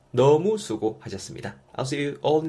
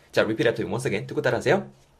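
ordinary delivery

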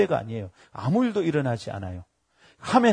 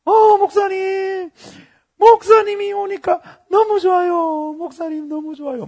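hammed-up, high-pitched fan squeal; drawn-out, delighted mock squeal; overjoyed greeting; 14 semitones higher, 8 dB louder than usual. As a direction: imitate a gushing admirer, loud then easing off. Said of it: "너무 기뻐하더니 내려오는데 내보고 이럽니다".

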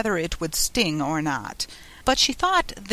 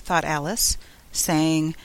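usual speaking voice

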